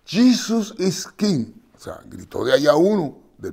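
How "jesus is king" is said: loud, slow, high call of Jesus is King; shouted from the crowd. Retold or imitated. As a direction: yell it out from the back of the crowd. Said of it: "grita uno".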